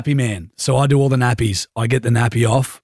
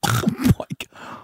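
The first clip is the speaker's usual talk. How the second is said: whispered disbelief; 4 dB quieter than usual. Retold or imitated. Stunned parents at baby's outburst.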